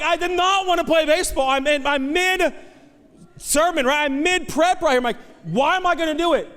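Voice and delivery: high, loud venting; exasperated protest. Retold or imitated. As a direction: protest like an interrupted, pestered dad. Exasperated dad retelling his protest.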